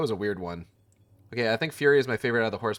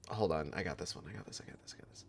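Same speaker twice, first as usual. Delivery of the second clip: whispered to himself under breath; whispering; 5 semitones lower, 16 dB quieter, faster than usual. Real, not acted. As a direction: hush it, just for yourself. Muttering to self while playing.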